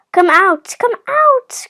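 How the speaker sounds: loud, high coaxing call. A narrator's reading. Mother Duck calls her ducklings from their shells, calling out. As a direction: call the little ones out. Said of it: "called Mother Duck".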